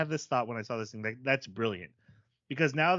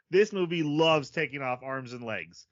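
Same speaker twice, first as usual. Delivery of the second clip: normal talking voice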